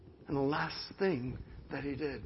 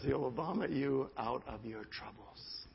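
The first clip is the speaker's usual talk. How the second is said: usual speaking voice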